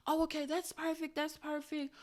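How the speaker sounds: high, light agreeable phone voice; client's mother. Retold or imitated. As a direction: chirp it politely, imitating the mother. Mother's reply on the call.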